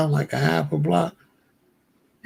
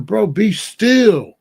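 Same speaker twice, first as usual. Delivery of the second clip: slow, drawn out, louder and higher; urgent plea; 6 semitones higher, 6 dB louder, much slower than usual. Retold or imitated. To his friend under a chokehold, loud slow warning. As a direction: stretch every word to make him freeze. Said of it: "I'm telling him".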